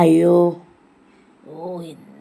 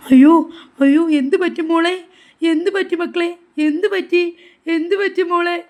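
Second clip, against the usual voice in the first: alarmed concern; 3 semitones higher, 5 dB louder than usual. Acted. Grandmother rushing to a crying child, alarmed concern. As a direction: cry out in worry, voice jumping up.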